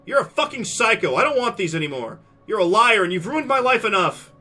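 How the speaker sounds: high, loud raging accusation; furious shout. Acted. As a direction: spit it at him in fury. Mia throwing pills.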